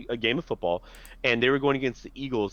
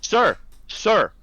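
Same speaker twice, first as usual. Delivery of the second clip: higher, louder insistent calling; pestering stranger; 9 semitones higher, 5 dB louder, much slower than usual. Retold or imitated. Imitating a stranger interrupting a phone call, insistent. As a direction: imitate someone nagging for attention. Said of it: "wouldn't stop saying it to me".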